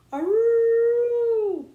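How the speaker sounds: long high loud howl; dog howl. Acted. Puppy howling along with the prayer, high and long.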